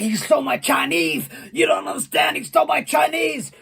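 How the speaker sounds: high, worked-up wrestler voice; screaming protest. Acted. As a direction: imitate an outraged man pleading his case. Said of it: "he basically was screaming".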